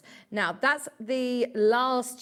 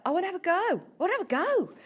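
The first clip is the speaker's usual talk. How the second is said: high, eager, swooping plea; excited; 9 semitones higher, much faster than usual. Retold or imitated. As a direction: retell it bursting with keenness to join in. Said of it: "how excited she was".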